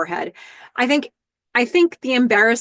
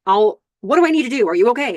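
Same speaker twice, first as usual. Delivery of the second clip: protective; 4 semitones higher, 6 dB louder, faster than usual. Retold or imitated. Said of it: "ready to protect".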